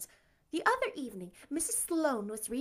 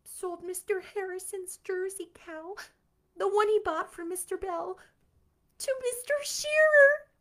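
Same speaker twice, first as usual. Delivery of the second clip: higher, rising to a wail; distraught confession; 9 semitones higher, 6 dB louder than usual. Acted.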